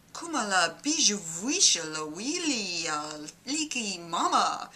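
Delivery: light mock glossolalia babble; speaking in tongues. Comedian showing another imposter god's tongues. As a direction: roll out nonsense ending in ah.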